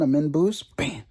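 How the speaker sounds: usual speaking voice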